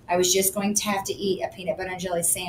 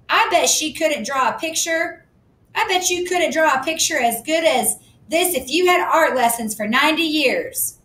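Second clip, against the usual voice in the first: indignant; 8 semitones higher than usual. A narrator's reading. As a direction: defend herself, offended. Susie angry retort.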